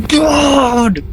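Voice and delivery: ordinary delivery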